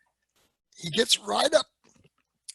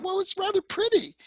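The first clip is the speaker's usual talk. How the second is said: high, light voice for the sister-in-law; sister-in-law's voice; 5 dB louder than usual. Retold or imitated. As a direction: lift it up high as she admires the box. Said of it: "she thought".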